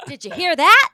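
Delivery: high, loud triumphant call; wife boasting to husband, loud excited shout; excited proud shout. Retold or imitated. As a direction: crow about keeping calm. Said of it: "I said".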